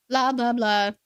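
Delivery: loud mocking blah-blah; loud, dismissive interruption. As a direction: talk over the boring news. Cuts off the news report.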